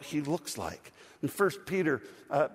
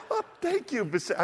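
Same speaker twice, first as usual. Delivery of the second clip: laughing thanks; 7 semitones higher than usual. Retold or imitated. Laughing reply to son.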